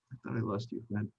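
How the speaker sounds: soft, lightly hushed tearful-friend line; stage whisper. Acted. Potts hugging his revived friend, soft stage whisper. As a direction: murmur it so all still hear. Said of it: "whispers it, but you can all hear it".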